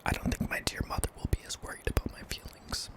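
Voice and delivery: usual speaking voice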